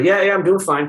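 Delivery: a lightly voiced patient waving it off; mock patient. Patient lifting her head to answer her chiropractor, offhand. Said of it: "lifted her head and go".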